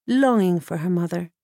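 ordinary delivery, nothing marked

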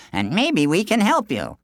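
villagers' voice, pitched high; helpful villagers. A narrator's reading. Villagers offer the fugitive help, high helpful voice. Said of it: "they said".

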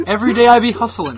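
usual speaking voice

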